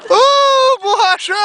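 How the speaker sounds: loud, high excited cheer; excited whoop. Real, not acted. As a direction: whoop at the big fall. Friends cheering a fall on ice. (grito animado)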